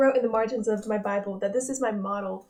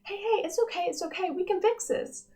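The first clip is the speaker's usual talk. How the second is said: much higher, bright fix-it cheer; upbeat Joy voice; 9 semitones higher than usual. Retold or imitated. Joy rushing to fix Bing Bong's sadness, much higher and cheery. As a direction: chirp it out like eager Joy. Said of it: "Joy goes".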